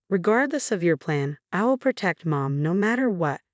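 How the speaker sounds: ordinary delivery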